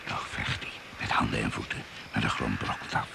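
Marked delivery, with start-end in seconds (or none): none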